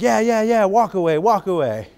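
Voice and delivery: high, loud sarcastic dismissal; sarcastic wife. Retold or imitated. Wife mocking her husband walking off, loud and high. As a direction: shout it mockingly as he leaves. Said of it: "She's like".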